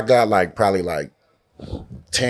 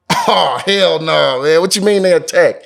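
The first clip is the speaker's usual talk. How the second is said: higher, louder mock panic; loud, pitched-up refusal, hammed up; retold alarmed protest; 6 semitones higher, 5 dB louder than usual. Learning from cameraman that boars attack, retold alarmed protest.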